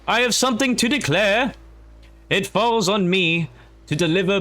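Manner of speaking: high-pitched mock British orator; British accent. Player reads the speech to the followers, mock British accent. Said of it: "I don't know why I'm British".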